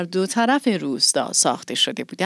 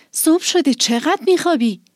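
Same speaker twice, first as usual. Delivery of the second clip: high, raised teasing call; 8 semitones higher than usual. Acted. Girl scolding her cat for oversleeping, raised and high. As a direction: chide the sleepyhead aloud. Waking the lynx, calling.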